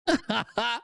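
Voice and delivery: short, high chuckle; amused laugh. Real, not acted. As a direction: laugh at his angry post. Laughs at the angry post.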